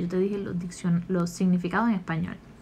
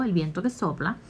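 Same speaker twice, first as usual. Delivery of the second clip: normal talking voice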